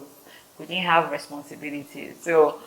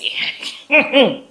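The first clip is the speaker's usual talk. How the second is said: high giggle; 7 semitones higher, 5 dB louder than usual. Real, not acted. Laughing at own story.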